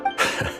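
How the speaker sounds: short, quiet laugh; brief low-key chuckle before answering; soft chuckle. Acted. Amused reply to a question about collector friends, soft chuckle.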